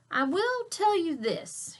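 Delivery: slow, high, mysterious dad voice; secretive dad. Acted. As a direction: tease with a big secret. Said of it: "he said".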